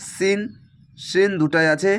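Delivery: ordinary delivery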